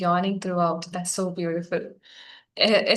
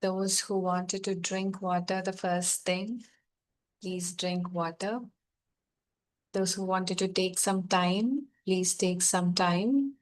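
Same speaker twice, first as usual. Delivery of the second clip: soft, slow meditation voice over music; guided meditation; 7 dB quieter, slower than usual. Acted. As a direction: gently ease them back to the room.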